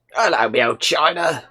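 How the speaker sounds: lightly voiced Cockney geezer; put-on Cockney accent. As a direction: do a chirpy London Cockney. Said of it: "Where do you get that accent from?".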